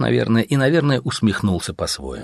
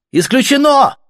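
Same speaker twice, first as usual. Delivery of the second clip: much higher and louder, shouted out; 7 dB louder than usual. A narrator's reading. Artyom shouting his refusal at the officer. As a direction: fling the refusal out as a yell. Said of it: "криком повторил Артём".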